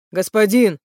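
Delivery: loud, short exclamation; exclaiming. A narrator's reading. Барк exclaims to his master, calling out. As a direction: call out to a master. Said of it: "воскликнул Барк".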